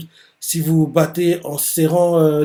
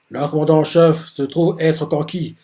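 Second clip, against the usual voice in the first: ordinary talk, nothing marked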